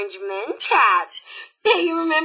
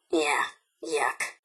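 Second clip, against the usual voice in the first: disgusted; 9 semitones lower, 6 dB quieter than usual. Acted. Disgust at malformed friend.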